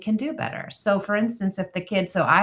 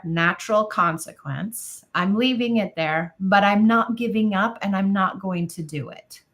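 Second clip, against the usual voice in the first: usual speaking voice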